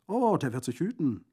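loud, high, lively bandit voice; jovial bandit, admiring. Acted. Bandit praises the lieutenant's rifle, jovial and loud. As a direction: crow over the shooting with booming gusto.

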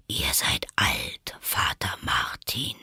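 low, slow, whispered drawl; sleepy caterpillar. A narrator's reading. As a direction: prompt it drowsily, half whispered. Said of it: "mit schläfriger, langsamer Stimme". (tief, langsam und schläfrig gehaucht)

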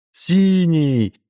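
put-on high tearful child's wail; crying little boy. A narrator's reading. Boy to the stranger, tearful high child's wail. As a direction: wail it like a small boy who lost his ball. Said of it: "ребёнок заплакал пуще прежнего".

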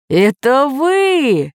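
high, long drawn-out disbelief; amazed exclamation. A narrator's reading. Geographer not believing his eyes, amazed drawn-out cry. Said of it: "воскликнул географ".

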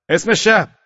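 high, loud challenge; sentry's shout. A narrator's reading. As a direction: challenge a shadow in the dark, shouting. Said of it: "نگهبان فریاد زد". (فریاد نگهبان)

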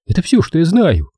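high-pitched woman, curt answer; brusque woman's retort; sharp reply. A narrator's reading. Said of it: "ответила Дел резко".

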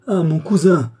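cri joyeux, haut et fort; éclate de bonheur; cri de joie. A narrator's reading. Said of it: "s'écria Louis". (s'écrie de joie)

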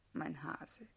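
soft breathy whisper of a pet name; hushed little pet name; whispered endearment. Acted. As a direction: whisper it close, tender and teasing.

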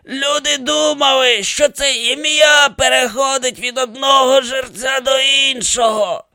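high, slow creaky mummy voice; creaking accusation. A narrator's reading. Dead pharaoh accuses the priest who outlived them, creaking. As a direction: creak out a long, drawn-out grievance. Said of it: "проскрипів він".

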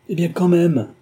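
loud, high indignant exclamation; exclaiming. A narrator's reading. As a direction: burst out, refusing the excuse. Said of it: "m'écriai-je". (s'écrier, fort et très aigu)

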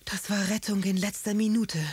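strained, relieved groan; groaning relief. A narrator's reading. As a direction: moan it out after a narrow escape. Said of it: "stöhnte Karlchens Großvater".

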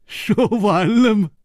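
sinister taunt. Acted. Li Yuhan taunting the others, slow and eerie. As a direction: drag out the mocking question.

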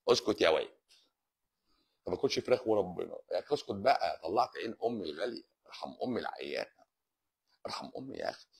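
low, soft pretend plea to be left alone; mock pleading. Host mock-begs the nagging viewer Haitham, low and soft. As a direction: beg him to spare your sick mother, half-joking.

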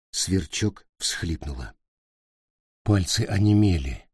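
ordinary delivery, nothing marked

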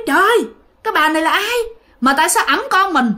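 alarmed exclamation. Retold or imitated. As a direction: cry out at a stranger holding your baby. Mother sees stranger taking her baby. (giọng hốt hoảng, lên cao)

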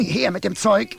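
very high, loud demand; angry shout. Acted. Shoemaker tugging at philosopher, high angry shout. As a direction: yank and yell. Tug-of-war over clothes.

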